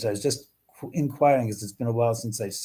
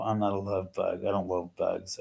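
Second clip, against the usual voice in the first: ordinary talk, nothing marked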